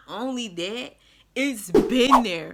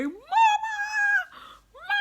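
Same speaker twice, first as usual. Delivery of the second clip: re-enacted high-pitched holler; 5 dB louder, much faster than usual. Narrator calling her mom from the bathroom, hollering. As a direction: holler for help, voice shooting way up. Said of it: "I just got to hollering".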